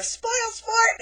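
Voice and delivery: high, loud mock yell; mock yell at the nun; screamed. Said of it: "I just screamed at her".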